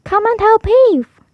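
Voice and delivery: high, loud, slow call to heave; calling for help. Acted. Girl calling the dog to help pull. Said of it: "called the girl to a dog".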